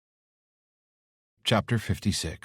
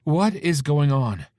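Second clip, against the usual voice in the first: alarmed mother; 8 semitones higher, 7 dB louder, slower than usual. Acted.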